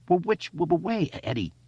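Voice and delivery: high, urgent stammered question. Acted. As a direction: press for an answer fast. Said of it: "he asked urgently".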